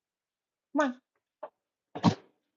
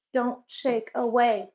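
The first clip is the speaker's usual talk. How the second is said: slow stern repeat; 5 dB louder, slower than usual. Real, not acted. Repeating command, slower.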